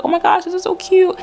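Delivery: high mock squeal; imitating a squeal. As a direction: replay your own squeal of delight. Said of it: "I was squealing and I was like".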